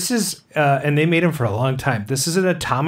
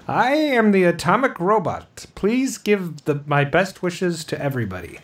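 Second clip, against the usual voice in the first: toy robot voice; 5 semitones higher than usual. Retold or imitated. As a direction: imitate a talking toy robot. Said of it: "but he says".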